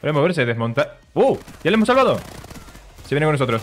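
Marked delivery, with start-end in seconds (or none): 1.1-2.2 high, loud cheer of triumph; excited shout. Real, not acted. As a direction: shout it out, we did it. Rescue success in game.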